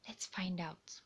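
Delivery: put-on soft secret whisper to the kids. Storyteller whispering to young listeners before the story. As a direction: lean in and breathe it.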